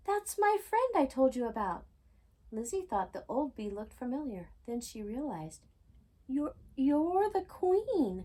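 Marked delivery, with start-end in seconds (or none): 0.1-1.9 high excited call; shouted. A narrator's reading. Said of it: "Daisy shouted". 6.3-8.2 slow, stammering, high amazement; stunned realisation, drawn out; exclaimed. A narrator's reading. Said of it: "she exclaimed".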